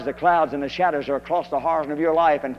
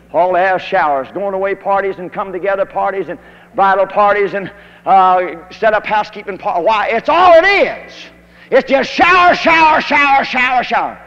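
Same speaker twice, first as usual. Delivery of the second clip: loud mocking preacher's rant, building higher; preaching at full force; 3 semitones higher, 9 dB louder than usual. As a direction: hammer out shower, shower, shower with scorn. Mocks church showers, building up.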